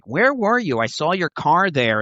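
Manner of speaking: a touch of irritation, not rude; mildly annoyed question. Retold or imitated. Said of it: "she wasn't a jerk".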